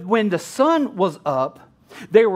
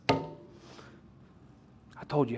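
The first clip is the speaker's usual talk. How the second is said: slow unvoiced whisper; whispered aside; 11 semitones lower, 17 dB quieter, much slower than usual. Real, not acted. Preacher whispering to the congregation, slow aside.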